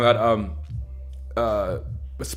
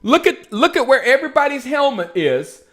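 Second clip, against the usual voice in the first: ordinary talk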